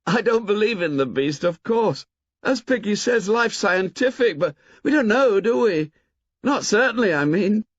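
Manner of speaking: put-on high boyish voice, light and merry; a cheerful lad brushing it off; gaily. A narrator's reading. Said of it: "laughed gaily".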